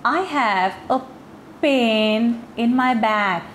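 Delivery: "pain" with a long ay vowel, "back" for bag, said very slowly; slow deliberate wrong vowel in "pain"; mispronounced pen as pain. Shown as a demonstration. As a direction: stretch "pain" into a long ay sound. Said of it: "अगर एह का sound मैंने एह करके नहीं pronounce किया".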